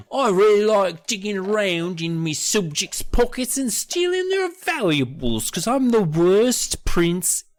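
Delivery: high, hammed-up British accent; mock British prince. Prince gloating over subjects' pockets, in a mock British accent. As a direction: put on a high, silly British royal accent. Mocks Eddie as a thieving prince.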